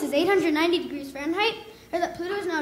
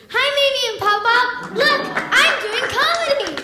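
excited; 7 semitones higher, 5 dB louder than usual. Real, not acted. Greeting grandparents in audience.

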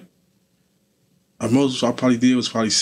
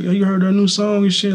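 higher-pitched, lively quote of others; excited retelling; 8 semitones higher, 4 dB louder than usual. Retold or imitated. Inmate quoting people hyped about his crew's new song, excited retelling. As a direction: imitate someone buzzing over news.

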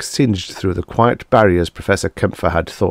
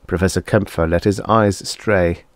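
plain reading voice

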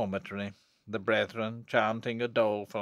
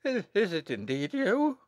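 high, faltering question; stunned disbelief; 8 semitones higher than usual. A narrator's reading. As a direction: stammer it in shocked recognition. Abbot recognises his supposed victim.

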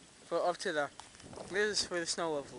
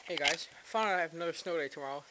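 usual speaking voice

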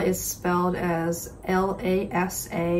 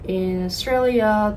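usual speaking voice

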